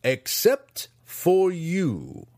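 snarled. Acted. Master singling out Drizzt, slow snarl. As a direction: spit it at the student. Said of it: "Hatchnet snarled".

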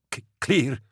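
high, stammered gasp; a choking driver gasping back an answer. Acted. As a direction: choke the word out while being throttled. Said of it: "gasped Tuvant".

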